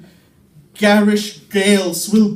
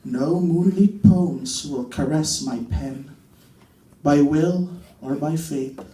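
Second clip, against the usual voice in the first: Neruda-style reading; 5 semitones lower, 4 dB quieter than usual. Acted. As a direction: murmur each word like a lovesick poet, with long pauses. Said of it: "Pablo Neruda".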